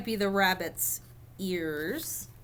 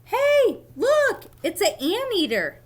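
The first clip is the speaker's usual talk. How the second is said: very high, loud thrilled shout; excited exclaim; 10 semitones higher, 6 dB louder than usual. Acted. One reader shouts to the other about an anteater, high and loud. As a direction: squeal with delight at the find.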